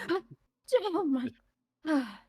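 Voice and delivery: high startled gasp, then catching breath. Acted. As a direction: jump out of your skin.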